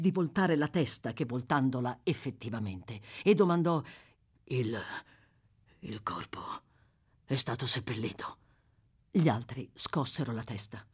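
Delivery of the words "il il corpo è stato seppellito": low, halting question dropping to a whisper; hushed, halting. A narrator's reading. Murderer asks companions about his victim's body, low and halting. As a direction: falter and nearly whisper the dreaded word.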